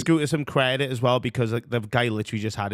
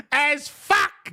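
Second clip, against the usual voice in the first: gleeful echo; 13 semitones higher, 3 dB louder than usual. Real, not acted. Echoing the clip line.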